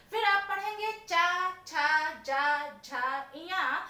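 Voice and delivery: चा छा जा झा या half-sung and drawn out slowly; palatal sounds demo. Shown as a demonstration. Teacher chants palatal letters slowly for the class. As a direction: chant चा, छा, जा, झा, या so the tongue on the palate shows. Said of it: "उच्चारण करते समय आपकी जिह्वा हल्की सी तालु को स्पर्श करती है".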